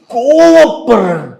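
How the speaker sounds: slow, high, drawn-out wail; mock crying. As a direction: stretch it into a hammed-up sob. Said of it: "அழுவாதீங்க sir".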